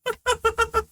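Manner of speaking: high, giggly disbelief; laughing. Real, not acted. Player revealing both dice to the table, laughing. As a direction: pitch it up, breaking into a laugh at the end. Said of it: "that's why I'm laughing".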